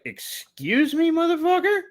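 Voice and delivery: mock-offended high pitch; indignant disbelief. Imagined indignant reaction.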